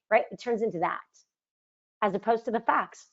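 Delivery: ordinary talk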